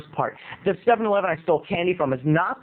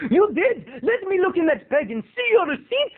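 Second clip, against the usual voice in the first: high, demanding mock Indian accent; hammy Indian-accented shop owner; 12 semitones higher than usual. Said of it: "giving this guy an Indian accent".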